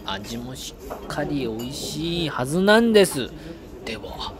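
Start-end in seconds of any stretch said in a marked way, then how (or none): 3.8-4.3 lightly voiced gourmet inner monologue; quiet, slow musing over food; Goro narration voice. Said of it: "五郎さんのナレーション".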